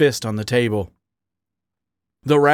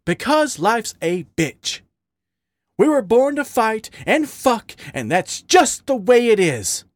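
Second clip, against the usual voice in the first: heated, higher-pitched retort; snarled; 10 semitones higher than usual. A narrator's reading. Raccoon answering his partner's demand, snarled retort. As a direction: snap back bitterly. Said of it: "Wally snarled".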